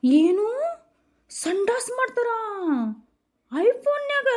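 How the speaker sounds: high, loud astonished cry; shocked disbelief. Acted. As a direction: shriek it in disbelief. Shocked wife mishears.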